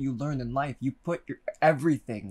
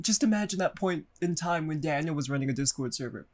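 usual speaking voice

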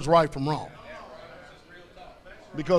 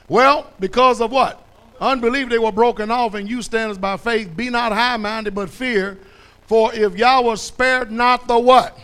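loud, pressing verse reading; preached scripture reading; 3 semitones higher, 6 dB louder than usual. Acted. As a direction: drive the verse at the listeners.

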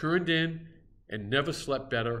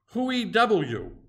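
higher, louder savage challenge in the dark; gruff cannibal voice; 8 semitones higher, 6 dB louder than usual. A narrator's reading. Harpooner startled in bed demands who's there, loud.

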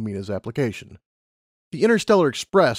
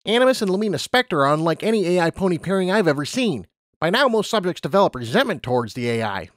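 higher, matter-of-fact lab pony; brisk scientist explaining rules; lab unicorn; 7 semitones higher than usual. Acted.

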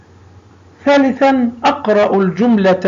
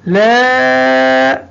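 slow, with the long vowels stretched; drawn-out demo; 4 semitones higher than usual. Shown as a demonstration. Teacher stretching the long vowels in التلاميذ. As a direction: draw the long aa out slowly. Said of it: "لاحظوا أين الحرف الممدود".

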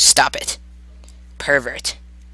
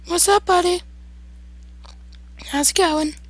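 high falsetto small talk; chatty squeak, sinking toward the end; friendly squeaky voice. Pretend. Player making small talk with monster, friendly falsetto.

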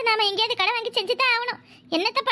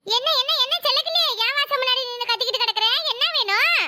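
higher, raised retort at the door; confronting neighbour; 4 semitones higher than usual. Acted. Geetha confronts Sellakili at her door, raised retort. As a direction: answer back from the doorway.

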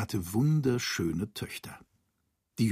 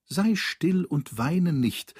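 lightly voiced frog, higher and slow; consoling frog; 6 semitones higher, slower than usual. A narrator's reading. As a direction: slowly hush the princess as the frog. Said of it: "antwortete der Frosch". (leicht gespielter Frosch, langsam und höher)

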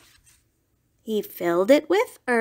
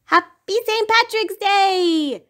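cheerful call, high and loud; 10 semitones higher, 4 dB louder than usual. Acted. Rabbit and Goat greeting everyone at the parade. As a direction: call it out to the crowd. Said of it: "they called out".

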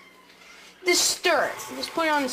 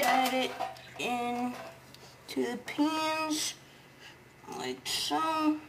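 quiet murmur; 5 semitones lower, 10 dB quieter than usual. Real, not acted. Pouring batter, voice drops right down.

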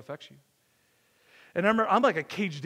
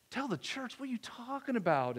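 pitched-up disbelieving question; incredulous; 5 semitones higher, 6 dB quieter than usual. Retold or imitated. Pastor balking at counselor's advice, incredulous. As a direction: balk at the idea, in disbelief.

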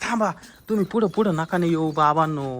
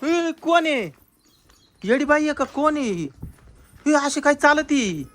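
alarmed questioning; 7 semitones higher than usual. Acted. Man spots a strange woman walking oddly, alarmed. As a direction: call out in rising alarm at a stranger.

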